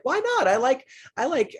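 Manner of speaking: hammed-up high-pitched complaint; mock indignant squeak. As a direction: squeal the objection like the slighted sister. Sister protesting the matchmaking.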